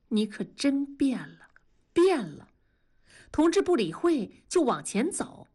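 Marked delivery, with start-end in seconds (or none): none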